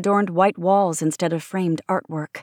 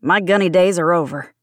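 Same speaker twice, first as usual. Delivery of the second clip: louder, mildly scornful dismissal; dismissive snort; 6 dB louder than usual. A narrator's reading. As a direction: brush off the old rank, firmly. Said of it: "Shepard snorted".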